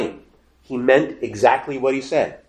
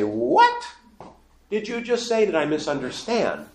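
incredulous reaction; 7 semitones higher than usual. Acted. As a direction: stop short in stunned disbelief. Listener's stunned reaction acted out.

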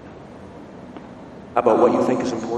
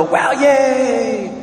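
high-pitched, drawn-out mock cheer; imitated crowd cheer, high and long; 10 semitones higher, 4 dB louder than usual. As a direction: cheer like an amazed circus crowd. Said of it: "the crowds all go".

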